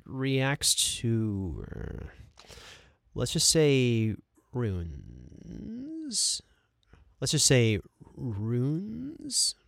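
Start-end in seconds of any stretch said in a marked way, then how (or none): 4.5-6.4 long, stretched "runes" with a hissing end; drawn-out take. Shown as a demonstration. Voice actor trying the line different ways, "runes" long drawn out. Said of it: "do that a couple different ways". 8.2-9.5 "runes" stretched out and swooping upward; drawn-out rising take. Shown as a demonstration. Voice actor trying the line different ways, "runes" rising and drawn out. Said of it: "do that a couple different ways".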